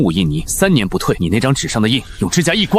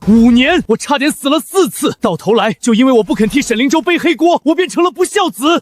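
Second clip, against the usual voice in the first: anguished shout; 14 semitones higher than usual. Acted. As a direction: hurl the years of pain at her. Anguished outburst at mother.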